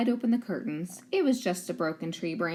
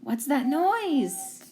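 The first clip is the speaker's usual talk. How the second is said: nervous whisper-ish; 6 semitones higher, 3 dB louder, much slower than usual. Acted. Scary night moment, slow.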